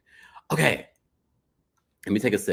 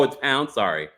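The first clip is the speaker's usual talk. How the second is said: ordinary delivery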